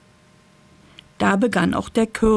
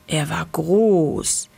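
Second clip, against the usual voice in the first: usual speaking voice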